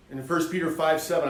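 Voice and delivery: ordinary delivery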